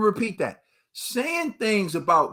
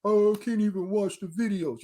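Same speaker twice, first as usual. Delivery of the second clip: mocking commenter; 2 semitones higher than usual. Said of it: "you know what's so sad".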